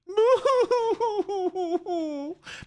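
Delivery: mocking laughter; high mocking laugh. Real, not acted. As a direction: burst out laughing in ridicule. Laughing at protester.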